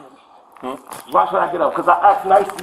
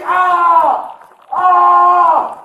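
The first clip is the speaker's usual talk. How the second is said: short loud cries of pain; pained scream; 4 semitones higher, 4 dB louder than usual. Real, not acted. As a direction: yelp as the arm is bent. Arm twisted, screams.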